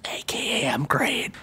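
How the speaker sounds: a whispered aside, unvoiced; tossed off in a whisper. Real, not acted. Quiet aside after self-deprecation.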